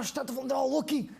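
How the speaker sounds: plain reading voice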